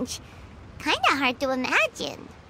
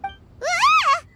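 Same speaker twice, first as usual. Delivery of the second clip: a sudden high yelp of surprise; startled cry; 13 semitones higher, 3 dB louder than usual. Acted. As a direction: jump at being caught. Startled at being discovered.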